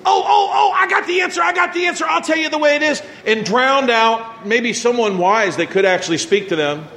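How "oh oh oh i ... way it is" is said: eager know-it-all. Retold or imitated. Pastor mocking a know-it-all who jumps in with answers. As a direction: blurt it out like the know-it-all who can't wait. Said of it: "you shouldn't be going".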